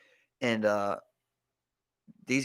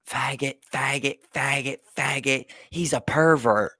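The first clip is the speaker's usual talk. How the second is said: imitated child's taunt, pitched up, building louder; retold name-calling; 4 semitones higher, 5 dB louder than usual. Little girl insults her stepdad on video, retold taunt. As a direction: chant the insult, then push the accusation louder.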